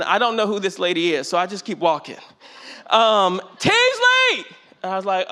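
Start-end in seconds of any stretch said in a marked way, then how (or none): 3.6-4.5 calling out a name. Retold or imitated. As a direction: hail him from far off by the door. Lady calling again, louder scene.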